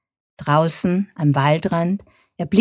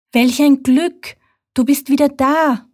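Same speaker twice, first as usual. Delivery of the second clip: joyful call; 9 semitones higher, 5 dB louder than usual. A narrator's reading. Mother fox greets her returning child with a joyful cry. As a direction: call out in relief, higher. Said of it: "ruft Mama Fuchs".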